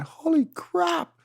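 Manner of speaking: high, drawn-out awed exclamation; seeing a monster wave, stunned and slow; awestruck. Retold or imitated. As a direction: stretch it slow in pure amazement. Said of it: "And I'm just going".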